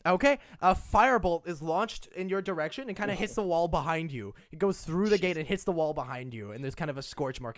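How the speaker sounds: normal talking voice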